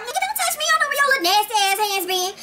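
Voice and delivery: very high, fast exasperated squeal; exasperated Tasha. Acted. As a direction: throw your hands up, pitched sky high. Tasha voiced, recoiling.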